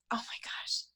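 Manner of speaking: hushed, breathless oh my gosh; soft whispered disbelief; stunned whisper. Real, not acted. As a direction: whisper it in shock. Stunned by son's question.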